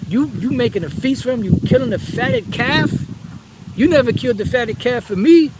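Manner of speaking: high, whiny complaint of a jealous son; resentful older brother. Retold or imitated. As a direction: act out the sulking son griping to his father. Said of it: "because he said".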